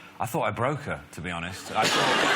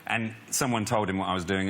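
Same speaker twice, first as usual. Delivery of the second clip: ordinary delivery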